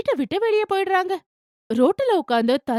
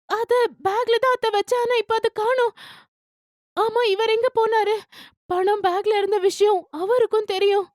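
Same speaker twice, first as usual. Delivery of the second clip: higher voice, anxious and pleading; worried young wife; 9 semitones higher than usual. A narrator's reading. Daughter-in-law explaining missing money to mother-in-law, higher anxious voice. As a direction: pitch up and worry over the lost money.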